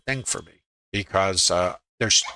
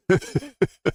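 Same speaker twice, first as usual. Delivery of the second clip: a quick high rueful laugh; short laugh; 10 semitones higher than usual. Real, not acted. Trader laughing at his own stopped-out trades, rueful chuckle. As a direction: laugh off the frustration.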